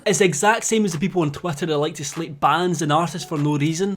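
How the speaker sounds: ordinary delivery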